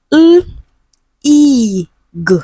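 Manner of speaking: "L, E, G" as isolated sounds: held l, long ee, short g; sounding out phonemes. Shown as a demonstration. Teacher breaks down legal for students, "L, E, G" as separate sounds. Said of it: "We have the schwa sound".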